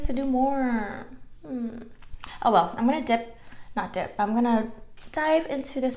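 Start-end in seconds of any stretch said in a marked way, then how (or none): none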